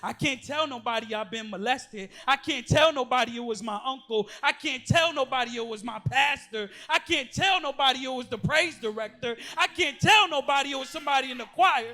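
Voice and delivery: preacher to abused youth in the room, loud and impassioned; full-force preaching. Acted. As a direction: cry it out to the congregation.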